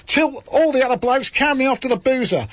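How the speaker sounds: put-on the same line retaken in the same Australian accent; a hammed-up Australian accent again, hitting all. Host retelling the fight after the interruption, in a bad Australian accent. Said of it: "I think I did my best".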